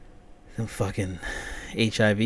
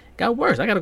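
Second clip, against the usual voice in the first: exasperated yelp; 12 semitones higher, 7 dB louder, faster than usual. Real, not acted. Stain smeared worse.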